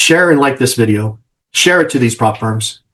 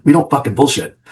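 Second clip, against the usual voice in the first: ordinary delivery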